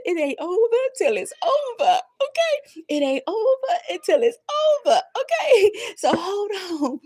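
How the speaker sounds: high, loud, laughing and tearful; laughing through tears. Real, not acted. Preacher urging viewers through tears. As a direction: push through tears to encourage.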